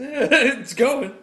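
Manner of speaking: high, loud laughing "It's going". Real, not acted. To co-host, laughing reply.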